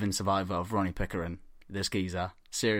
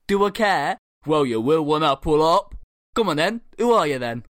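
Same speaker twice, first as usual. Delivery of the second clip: raised, louder confrontational retort; mock road-rage challenge; acted quarrel; 4 semitones higher, 5 dB louder than usual. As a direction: imitate two men squaring up. Acted roadside quarrel between driver and biker.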